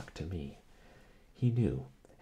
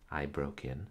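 usual speaking voice

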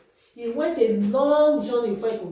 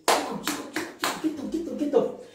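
urgent hushed call; 6 semitones higher, 3 dB quieter, faster than usual. Acted. Mother urgently wakes Jacob.

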